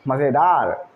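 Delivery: lightly imitated meme line, drawn out; a hint of meme voice; meme catchphrase. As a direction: quote the meme with drawn-out relish. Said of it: "वो बहुत चलती है meme".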